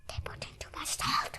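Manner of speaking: unvoiced secret whisper; whispering a gossip aside about the soup. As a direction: breathe it like a secret.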